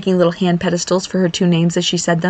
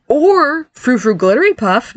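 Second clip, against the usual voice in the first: drawn-out, higher teasing suggestion; malicious glee; 6 semitones higher, slower than usual. Acted. Nick suggests a fairy name to Barry, malicious glee. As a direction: stretch out the silly name with relish. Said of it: "suggested with malicious glee".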